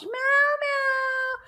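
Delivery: very high plaintive mew; meowing. Pretend. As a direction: mew like a sad kitten. Voicing the sad cat.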